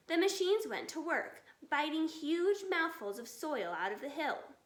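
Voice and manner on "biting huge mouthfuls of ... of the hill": ordinary delivery, nothing marked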